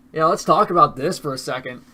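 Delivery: ordinary talk, nothing marked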